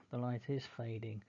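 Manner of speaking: usual speaking voice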